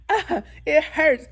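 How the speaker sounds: high, loud wailing imitation with a laugh; mock crying. Mother re-enacts hurting in labor, high mock crying. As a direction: act out wailing in labor pain. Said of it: "I'm dinner crying".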